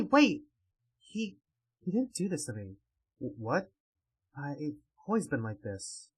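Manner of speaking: usual speaking voice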